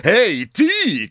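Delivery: high, loud hail; shouted call. A narrator's reading. Snape hailing the big Death Eater, shouting. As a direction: shout to get someone's attention. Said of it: "krzyknął do wielkiego śmierciożercy".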